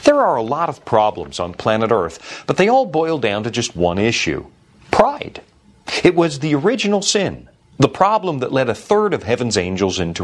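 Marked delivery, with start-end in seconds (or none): 4.9-5.4 "pride." jumps high with a rising tune; emphatic pride. Acted. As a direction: land "pride." as a high, rising single word. Sermon punchline on one word.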